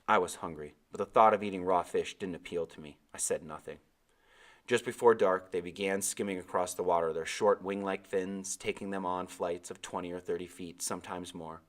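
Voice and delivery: plain reading voice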